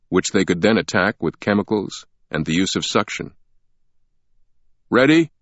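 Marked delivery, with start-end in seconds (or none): 4.9-5.3 called out loud through a megaphone; raised call over a megaphone. A narrator's reading. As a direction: technician calling out. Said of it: "a technician called out through a megaphone".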